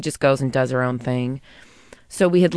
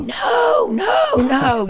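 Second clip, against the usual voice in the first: high, drawn-out cry of no, re-enacting panic; mock-frantic high shout; retold screaming; 4 dB louder, much slower than usual. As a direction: imitate yelling at a runaway dog, high and louder. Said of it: "screaming at the dog".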